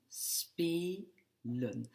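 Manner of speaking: spelen softly, as spe, pause, len; cut after long sound. Shown as a demonstration. Teacher repeating for students, spelen in separate syllables. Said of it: "après le son long".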